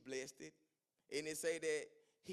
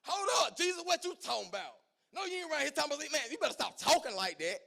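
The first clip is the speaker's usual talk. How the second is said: loud, high rebuke of Jesus; scolding rebuke; 9 semitones higher, 8 dB louder, faster than usual. Retold or imitated. Peter rebukes Jesus: loud high scolding. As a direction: Peter telling Jesus off, raised and sharp. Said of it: "Peter started to reprimand Jesus".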